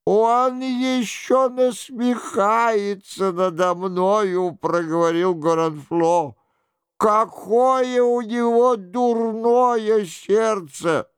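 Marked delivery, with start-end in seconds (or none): 0.1-4.5 high, drawn-out whine; pitiful, wounded complaint; plaintive lament. A narrator's reading. Monk laments his supposed tormentor. 7.0-11.1 high, slow, pitiful moan; woeful, wounded whine; plaintive lament. A narrator's reading. Monk laments his supposed tormentor.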